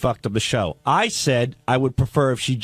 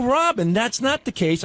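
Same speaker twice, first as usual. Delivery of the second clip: higher-pitched placating protest; retold plea; 7 semitones higher than usual. [name] retelling how he answered Robin, pleading. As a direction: try to calm her while denying it.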